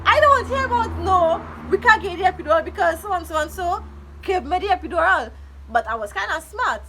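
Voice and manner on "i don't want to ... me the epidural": high, loud, fast pleading; desperate loud protest. Retold or imitated. To the nurses, in labour pain, a high loud protest. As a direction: cry out that you refuse to hear it. Retelling begging for epidural.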